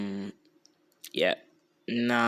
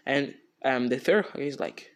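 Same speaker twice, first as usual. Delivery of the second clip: ordinary delivery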